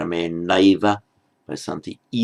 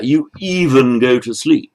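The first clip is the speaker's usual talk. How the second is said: rising, louder, aghast translation; horrified; 5 semitones higher, 5 dB louder than usual. Acted. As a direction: say it as if appalled. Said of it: "He's, he's speaking to them in horror".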